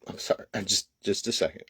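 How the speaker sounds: quiet, tearful apology; real tears, barely getting words out; crying. Real, not acted. Pausing his speech to classmates to cry, softly. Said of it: "I knew I was gonna cry".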